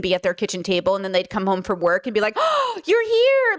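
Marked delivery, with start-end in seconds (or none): 2.4-3.6 a high, drawn-out delighted parent greeting; pleased parent. Retold or imitated. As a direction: stretch it like a pleasantly surprised parent. Parents coming home, pleased.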